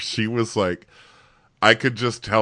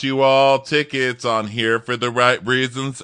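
mock host announcement; 6 semitones higher, 7 dB louder than usual. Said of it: "announcer parody".

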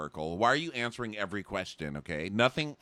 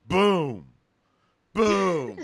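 loud booming entrance noise; hammed-up boom boom; mock boom; 9 semitones higher, 8 dB louder than usual. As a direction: boom like a dramatic reveal. Mock dramatic entrance sound.

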